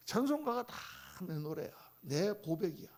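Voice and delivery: usual speaking voice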